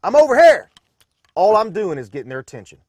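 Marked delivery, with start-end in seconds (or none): 0.0-0.7 loud, high call across the water; shouting to be noticed. Shown as a demonstration. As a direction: yell to get someone's attention. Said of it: "Or in humans' terms".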